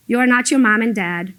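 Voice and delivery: ordinary talk, nothing marked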